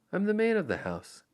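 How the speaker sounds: puffing himself up; bravado. Acted. John voiced in audiobook.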